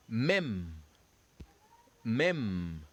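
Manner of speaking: "Mem" with a silent final e, one closed syllable; French "mem". Shown as a demonstration. Teacher models the silent final e for students. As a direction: say "Mem" clipped, the last e left silent. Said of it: "the last E ... silent".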